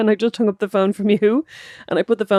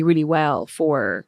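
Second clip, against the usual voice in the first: usual speaking voice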